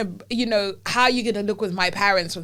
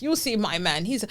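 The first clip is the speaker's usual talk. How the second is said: ordinary talk, nothing marked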